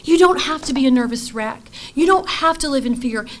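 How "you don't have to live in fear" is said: usual speaking voice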